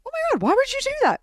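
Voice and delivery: high, fast, alarmed squeal; shocked principal. Retold or imitated. Principal hearing the student cursed a teacher, shrieking in shock.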